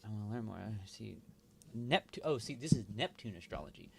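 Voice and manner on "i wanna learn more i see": ordinary delivery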